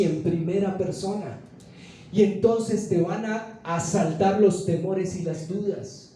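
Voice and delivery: ordinary delivery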